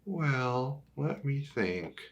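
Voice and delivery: plain reading voice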